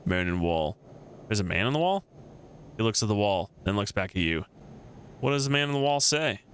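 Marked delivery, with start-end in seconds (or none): none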